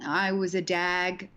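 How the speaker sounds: ordinary delivery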